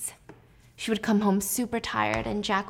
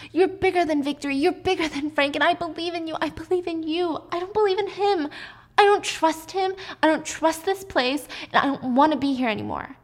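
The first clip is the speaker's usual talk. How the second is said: high, urgent, desperate pleading; begging; 7 semitones higher than usual. Acted. Wife begging Jack to believe in her, desperate. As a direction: plead with him to listen, voice pitched up. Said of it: "and she's begging".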